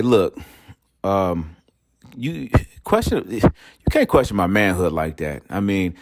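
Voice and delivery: ordinary talk, nothing marked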